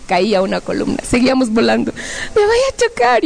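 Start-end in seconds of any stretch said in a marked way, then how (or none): 2.3-3.2 high-pitched mock panic; alarmed cry. Flyer about to hit a column, alarmed cry. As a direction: squeal it as the crash nears. Flying toward columns, crying out.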